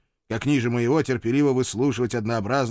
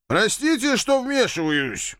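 higher, drawn-out mock apology; sarcastic interruption; 12 semitones higher, 3 dB louder, slower than usual. Demon butts into their table, mock-polite. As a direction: cut in with fake politeness.

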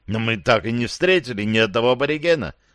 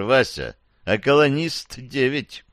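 ordinary delivery, nothing marked

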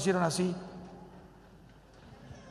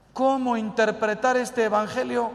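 ordinary talk, nothing marked

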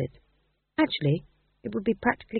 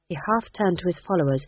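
plain reading voice

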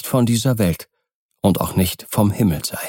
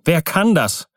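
mild cry; 7 semitones higher than usual. A narrator's reading. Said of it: "rief Markheim".

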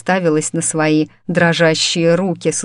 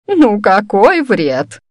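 put-on higher, drawn-out protest from an old woman; slightly raised voice; 4 semitones higher, 4 dB louder, much slower than usual. A narrator's reading. Old woman brushing off talk of harm, slightly raised voice. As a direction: protest slowly, lifting the voice a little. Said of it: "Она слегка повысила голос".

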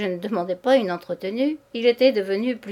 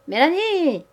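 higher, louder call down the hall; cry out; 8 semitones higher, 5 dB louder, slower than usual. A narrator's reading. Young woman calls the maid from a room, loud cry. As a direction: shout the maid's name from another room. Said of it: "une voix de femme cria".

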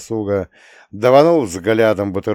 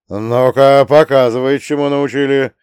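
louder commanding grandpa; ordering; 4 semitones higher, 7 dB louder than usual. A narrator's reading. Grandpa telling boy to show his guitar lesson, loud order. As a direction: give the order with raised volume. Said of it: "приказал дед Лёша".

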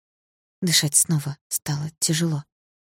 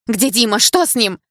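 loud, higher-pitched panicked questions; frantic demand; 6 semitones higher, 7 dB louder than usual. A narrator's reading. Injured girl asking mother about Dima, frantic. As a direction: press the questions out, loud and high.

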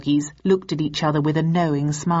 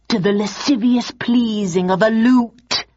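stage declamation swelling onto "lute"; LUTE said loudly; 4 semitones higher, 3 dB louder than usual. A narrator's reading. Richard over the audience's suggestions, "lute" pronounced loudly. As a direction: hit "lute" loudly at the end. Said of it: "saying lute loudly".

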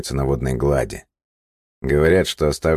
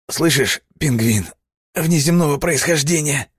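mocking high voice; 12 semitones higher than usual. Mocking the radio through pain.

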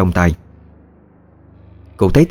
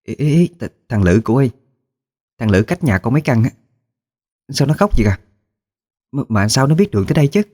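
higher, stammered, puzzled call; surprised exclamation; 5 semitones higher, faster than usual. A narrator's reading. Hiệp spots the neighbour boy crying, surprised. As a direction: exclaim in mild surprise, tripping on the first words. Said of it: "rồi kêu lên".

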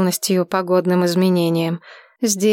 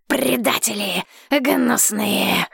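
hissing reptile growl; slower than usual. Acted. Dragon continues accusing.

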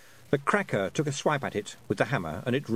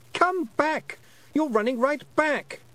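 put-on shouting boy; 4 dB louder, slower than usual. A narrator's reading. Roland calling after her.

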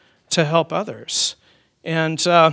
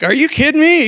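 loud, high incredulous cry; stunned employee blurting out to the CEO; shocked disbelief; 10 semitones higher, 8 dB louder than usual. Retold or imitated. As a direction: react as if you can't believe it.

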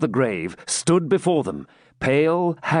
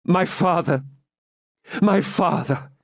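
earnest higher-voiced plea; pleading son; 6 semitones higher than usual. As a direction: implore your father, pitched up. Son kneels begging forgiveness.

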